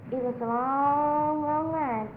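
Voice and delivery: LONG stretched out on a held note; very slow, sustained "long" before "line"; drawn-out LONG. A narrator's reading. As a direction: draw out "long, long" to show the length. Stretches words to show line's length.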